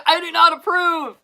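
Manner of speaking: loud, high mock disapproval; mock outrage. Reacting to the show, she loudly disapproves. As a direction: declare it with hammed-up indignation. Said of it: "It's like".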